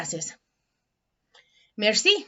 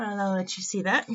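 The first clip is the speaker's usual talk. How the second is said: usual speaking voice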